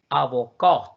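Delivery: "avocat" slowly, leaning on "cat"; last-syllable stress. Shown as a demonstration. Teacher asking where the stress falls, final-stressed "avocat". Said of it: "La última sílaba".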